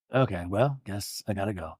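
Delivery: mock-dejected mutter trailing off; deflated mumble. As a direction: let it sag away, defeated. Eddie's deflated exit imitated.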